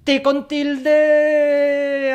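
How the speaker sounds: Ti con tilde wailed high, loud and very slow; pained exaggerated cry. Teacher to viewers, wailing in mock pain at a spelling error. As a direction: wail it as if the mistake hurts.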